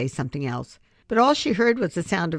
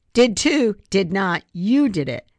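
put-on loud childish bickering; heated quarrel; 5 semitones higher, 6 dB louder than usual. Rex and Abby argue over the pumpkin, loud and heated. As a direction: fire back at her cousin.

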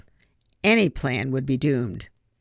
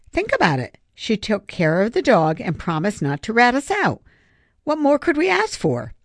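upbeat; 6 semitones higher than usual. Acted. Griffin upbeat rationalizing.

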